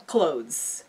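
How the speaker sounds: normal talking voice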